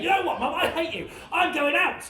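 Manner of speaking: high-pitched, bratty defiance at mum; cocky son. Acted. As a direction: throw it at your mum, high and insolent. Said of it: "being really, really cocky, being really horrible".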